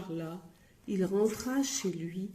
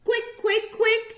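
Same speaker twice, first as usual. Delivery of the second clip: much higher squeaking mouse call; squeaky mouse cry; 14 semitones higher, 6 dB louder than usual. Acted. Noisy Breton mouse squeaking loudly at the listener. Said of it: "criait-elle".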